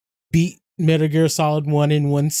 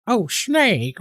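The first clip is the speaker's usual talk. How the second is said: higher-pitched put-on Chinese accent; mock Chinese accent; 7 semitones higher than usual. Streamer mimicking Mei Ling's needless accent, mock Chinese accent. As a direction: imitate a character's Chinese accent. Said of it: "doing, like, an accent".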